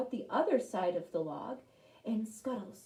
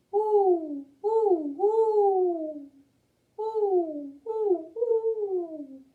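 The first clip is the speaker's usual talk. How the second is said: high, loud hooting owl call; owl hoots; 10 semitones higher, 7 dB louder, much slower than usual. Acted. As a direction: hoot like an owl, long falling notes. Imitating an owl's call.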